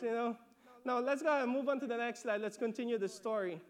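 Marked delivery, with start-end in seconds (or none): none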